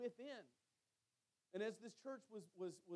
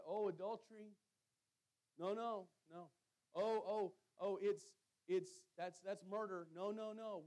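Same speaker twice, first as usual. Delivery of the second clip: louder, alarmed no no no; mock shocked refusal; 6 dB louder than usual. Believers recoiling from weight-bearing sins, mock alarmed refusal. Churchgoers testing sins like Jenga.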